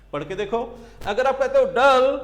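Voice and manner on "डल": डल in one loud, unbroken push; one unbroken syllable. Shown as a demonstration. Teacher showing students a single syllable, one loud unbroken push on डल. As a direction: say डल loudly without breaking the rhythm. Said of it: "beginning to end एक speed से आप निकल जाते हो".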